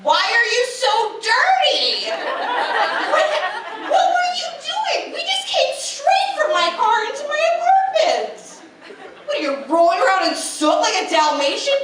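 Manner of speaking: high, laughing disbelief; incredulous outburst. Retold or imitated. Answering the hookup, high and laughing in disbelief. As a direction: squeal in mock outrage. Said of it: "And I was like".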